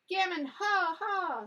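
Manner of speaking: high, loud cackling bird call; a loud, high magpie squawk; magpie doctor. A narrator's reading. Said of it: "said he, with his head on one side".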